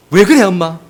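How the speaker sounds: loud, high snap at mother; defiant child. Retold or imitated. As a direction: talk back like a sulky teenager.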